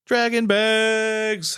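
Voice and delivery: sponsor announcer. Acted. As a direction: stretch the brand name like a hype announcer. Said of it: "Thanks again to our sponsor".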